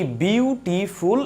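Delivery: "Beautiful" slowly in three separate beats; syllable by syllable. Shown as a demonstration. Teacher showing students a word, syllable by syllable. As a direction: break "beautiful" into বিউ, টি, ফুল. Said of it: "কয়টা স্টেপে এটা উচ্চারণ করতে পারছি".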